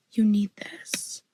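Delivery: slow, hushed imitation of a sales pitch; drawn-out mock-seductive whisper. Acting out a marketing pitch.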